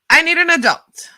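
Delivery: loud, high yell for help. Retold or imitated. Helper yelling from the porch for an adult, loud and high. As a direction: call out desperately from the porch. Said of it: "I yell out".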